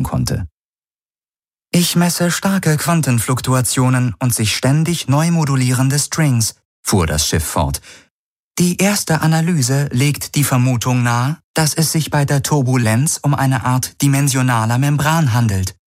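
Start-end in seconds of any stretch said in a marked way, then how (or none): 1.7-6.5 higher, even synthetic computer voice; high, neutral onboard computer reading out measurements; ship computer. Acted. Ship's computer reports. 8.6-15.8 higher, even synthetic computer voice; high, neutral onboard computer reading out measurements; ship computer. Acted. Ship's computer reports.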